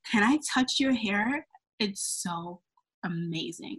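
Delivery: imitating a slow, low, entranced murmur; hushed awe. Coworker reaching for her hair, imitated hushed awe. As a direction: sink into an awed whisper.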